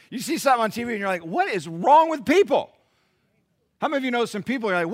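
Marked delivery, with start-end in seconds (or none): 1.2-2.7 exasperated. Retold or imitated. Mock exasperated reaction to TV.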